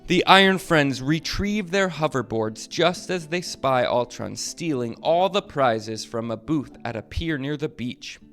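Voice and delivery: ordinary delivery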